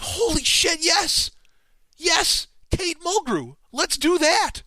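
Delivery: high, loud, overjoyed cry of yes; thrilled outburst. Retold or imitated. Host recalling hearing the casting idea, ecstatic high shout. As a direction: burst out like the best idea ever just landed. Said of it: "And I was just like".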